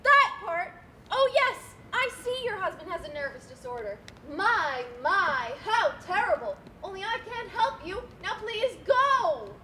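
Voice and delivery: boiling over with impatience; exasperated loud reply. Acted. Exasperated banker shouting back.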